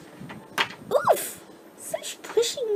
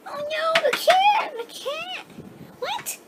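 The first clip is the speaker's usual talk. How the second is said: alarmed cry; 7 semitones higher than usual. Pretend. Kitten runs away.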